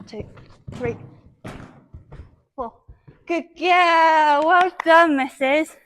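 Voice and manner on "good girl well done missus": gushing praise. Real, not acted. Praising pony after poles.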